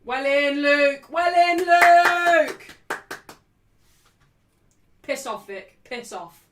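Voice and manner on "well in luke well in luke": loud praise of the player; cheering. Real, not acted. Fan cheering a player's good play. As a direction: call it out at the screen.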